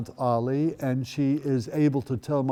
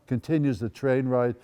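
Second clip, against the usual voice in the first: usual speaking voice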